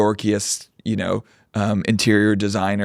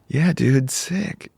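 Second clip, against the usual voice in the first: California dude; 3 semitones higher, much slower than usual. Retold or imitated.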